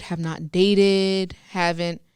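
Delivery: ordinary talk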